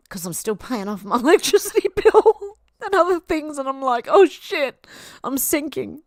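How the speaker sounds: real tears breaking through a laugh. Real, not acted. Streamer tearing up over chat donations, laughing while crying. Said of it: "I need a new tissue".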